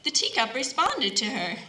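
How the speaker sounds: ordinary delivery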